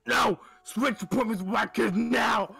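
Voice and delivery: high, loud shouted demand; mock yell. Entitled mother yelling to swap appointments, high shout. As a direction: scream it like a furious entitled mom.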